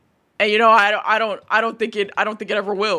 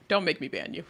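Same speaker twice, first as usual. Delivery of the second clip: ordinary talk, nothing marked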